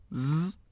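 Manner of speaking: "ز" as a voiced buzz; isolated z sound. Shown as a demonstration. Teacher lists the three suffix sounds, softly buzzed z. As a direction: say "ز" alone, soft buzzing z. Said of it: "سه نوع تلفظ داره".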